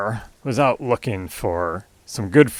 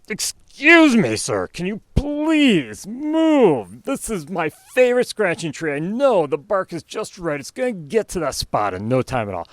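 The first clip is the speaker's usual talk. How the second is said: exasperated bear; 8 semitones higher than usual. Acted. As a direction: plead through clenched frustration. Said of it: "Bear's getting angry".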